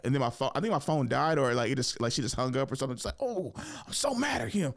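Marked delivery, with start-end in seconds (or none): 3.2-4.7 high-pitched exasperation; imitated fuming mom. Mom venting about her son, high mock fuming. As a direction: mimic a mother venting after hanging up. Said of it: "my mom freaking out".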